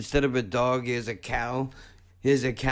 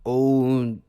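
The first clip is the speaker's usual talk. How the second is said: ordinary talk, nothing marked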